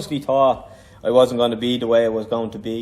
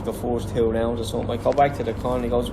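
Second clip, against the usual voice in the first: normal talking voice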